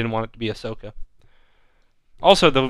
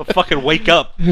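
mock ranting; 6 semitones higher, 5 dB louder than usual. Mock conspiracy rant.

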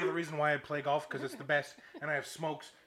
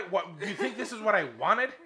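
loud, high exasperated question; indignant disbelief; 6 semitones higher, 7 dB louder than usual. Retold or imitated. Golfer answering the annoyed marshal, incredulous. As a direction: protest as if absurdly accused.

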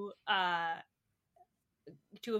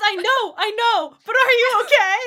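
much higher and louder, pleading; anxious boyfriend; 12 semitones higher, 15 dB louder than usual. Acted. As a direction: push the worried plea up loud and high. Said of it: "He says".

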